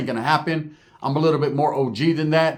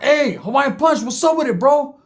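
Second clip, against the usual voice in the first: calling out; 9 semitones higher, 3 dB louder than usual. Retold or imitated. Calling out across the yard.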